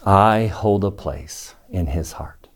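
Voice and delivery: measured and low, pausing before the last phrase; slow, reflective repetition. Real, not acted. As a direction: let the words sink in. Teacher repeats the line, reflectively.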